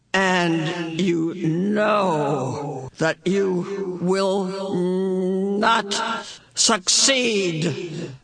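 very slow, echoing intonation; otherworldly Mrs Which. A narrator's reading. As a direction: stretch each word out like a reverberating apparition. Mrs Which intones her warning.

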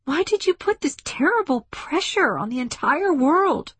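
very high, impassioned protest; demanding. A narrator's reading. Woman challenges the ship over her world, demanding. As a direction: press the accusation in a strained high pitch. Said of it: "she demanded".

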